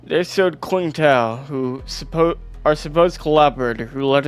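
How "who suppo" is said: normal talking voice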